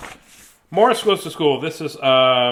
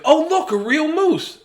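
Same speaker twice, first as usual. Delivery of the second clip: put-on high, slow kid voice; childlike wonder; children's voice; 13 semitones higher, 4 dB louder than usual. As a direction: gasp like amazed children.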